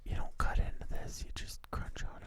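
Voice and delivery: hushed ASMR whisper. Real, not acted. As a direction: whisper softly for the mic. Both whisper for ASMR tasting.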